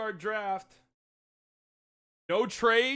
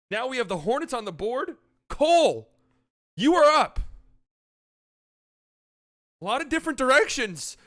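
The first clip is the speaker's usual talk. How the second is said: higher, louder hyped-up draft host; draft broadcast host; 4 semitones higher, 4 dB louder than usual. Pretend. Hosting the mock draft for friends, hyped broadcaster. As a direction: sell each pick like live TV.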